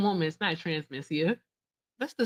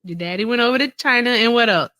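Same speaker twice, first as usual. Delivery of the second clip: taunting; 6 semitones higher, 9 dB louder than usual. Real, not acted. Mocking prompt to continue.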